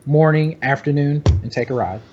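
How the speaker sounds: ordinary talk, nothing marked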